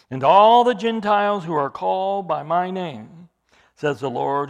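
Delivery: preacher reading scripture aloud with force. Real, not acted. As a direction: declaim the prophecy to the congregation. Reads Amos quote with force.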